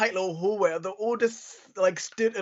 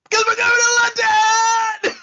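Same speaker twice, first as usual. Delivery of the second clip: huge high drawn-out shout; hammed-up host's big announcement, shrieked; camp; 10 dB louder, slower than usual. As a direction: scream it as an over-the-top camp reveal. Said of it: "it was very camp the way she said".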